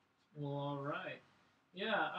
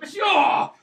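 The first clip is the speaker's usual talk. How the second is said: mock shout; 19 dB louder than usual. Acting out Cena busting in.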